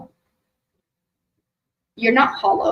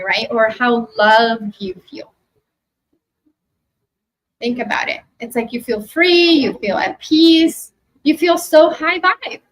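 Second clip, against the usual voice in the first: guided meditation. Acted. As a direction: guide them gently into the memory. Leads a meditation.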